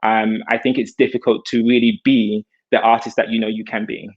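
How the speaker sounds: ordinary delivery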